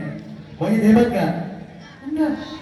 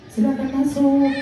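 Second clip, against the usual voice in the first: monkey puppet voice; 5 semitones higher than usual. Acted. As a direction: squeak it like a shy monkey puppet.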